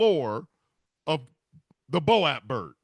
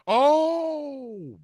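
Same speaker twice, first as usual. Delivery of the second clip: long high wail "oh"; crow cry of realization; 9 semitones higher, 6 dB louder than usual. Pretend. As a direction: let out a long wailing "oh". Crow's sudden realization cry.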